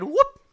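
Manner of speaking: sudden high, loud whoop; sharp, high cartoon fright; startled whoop. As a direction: yelp as if scared into your shell. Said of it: "finally he gets so scared".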